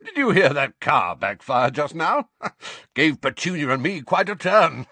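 high, loud fake-hearty chat with a laugh; forced jolly uncle. A narrator's reading. Uncle covering up the noise to neighbours, forced laughing. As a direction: play it breezy to cover up.

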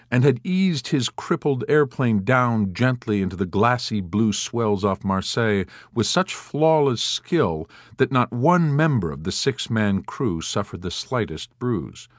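plain reading voice

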